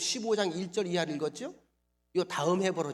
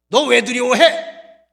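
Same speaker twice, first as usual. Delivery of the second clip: loud, slow, higher scold; 6 semitones higher, 18 dB louder, much slower than usual. Pretend. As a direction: bark it like a stern rebuke. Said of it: "이렇게 야단쳐야 정상이거든요".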